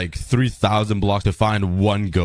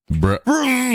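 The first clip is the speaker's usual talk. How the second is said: high, stunned bruh with a laugh; disbelieving bruh; 13 semitones higher than usual. Real, not acted. Gamer sees his named mob vanish, a high stunned bruh. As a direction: groan it out in disbelief.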